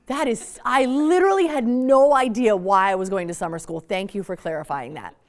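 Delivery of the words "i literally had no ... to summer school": sarcastic. Retold or imitated. Sarcastic teen continues.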